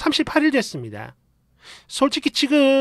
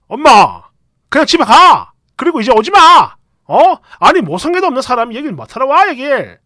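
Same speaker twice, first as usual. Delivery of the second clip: shouting; 7 dB louder than usual. Retold or imitated. Husband telling mother to leave.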